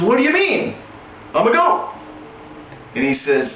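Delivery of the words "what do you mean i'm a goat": much higher, puzzled innocent voice; high, bewildered protest; confused young lion. Acted. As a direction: sound baffled, like a lion who truly thinks he's a goat. Confused lion who thinks he's goat.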